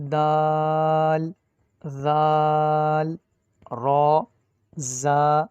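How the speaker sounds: loud, long-held Arabic letters; teacher reciting letters for pupils; Arabic letter names. Shown as a demonstration. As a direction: chant "ہا، خا، دال" with full Arabic vowels, no Urdu sound. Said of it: "درست انداز ہے وہ یہی ہے جو میں نے پڑھا ہے".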